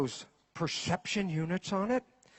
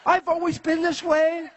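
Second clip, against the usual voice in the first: defensive partner; 9 semitones higher than usual. Retold or imitated. Person answering their partner, laughing and high-pitched in protest. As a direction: innocently insist nothing has changed. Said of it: "you look at your partner and go".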